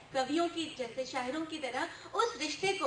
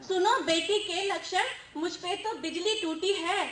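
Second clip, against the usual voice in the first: animated verse, building louder; humorous poem recital; 3 semitones higher, 4 dB louder than usual. Acted. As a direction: recite the flustered mother's tale to the hall. Recites poem, voicing worried mother. (जोशीला हास्य कविता-पाठ)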